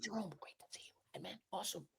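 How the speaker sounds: barely audible whispered greeting; quiet approach. Shown as a demonstration. Fan discreetly greeting a famous player, whispering. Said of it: "I'm more quiet approach".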